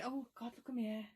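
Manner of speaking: ordinary talk, nothing marked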